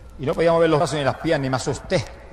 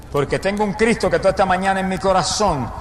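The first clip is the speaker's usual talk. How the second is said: raised testimony building into a loud shout; impassioned preacher declaring with full force; fervent preaching; 4 semitones higher, 4 dB louder than usual. Real, not acted.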